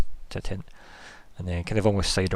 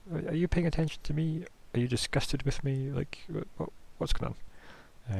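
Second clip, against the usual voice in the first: anxious questioning; 7 semitones higher, 5 dB quieter than usual. Retold or imitated. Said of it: "he has that look of".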